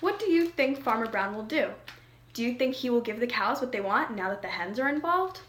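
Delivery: usual speaking voice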